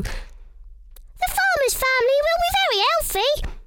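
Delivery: high squeaky little mouse voice. Acted. Missus Mouse talks to herself about the food, squeaky high. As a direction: pipe up like a tiny mouse.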